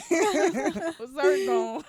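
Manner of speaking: high, drawn-out laughing words; laughing apology. Real, not acted. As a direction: apologise while cracking up. Laughing apology after crush joke.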